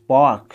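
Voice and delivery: पार्क said loud as demo; American park. Shown as a demonstration. Teacher showing American पार्क to students. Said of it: "अब यहाँ पे देखेंगे American English".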